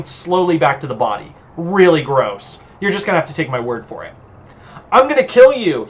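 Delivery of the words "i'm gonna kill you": high, loud growl of a threat. A narrator's reading. Vampire threatens the woman who impaled him, growling loudly. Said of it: "he growls out".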